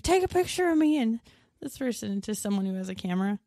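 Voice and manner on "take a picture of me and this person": imitating eager fan. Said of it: "they're like".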